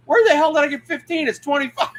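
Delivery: high-pitched retold disbelief; incredulous outburst. Man recalls reacting to his own video, incredulous. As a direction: squawk it in high, baffled disbelief.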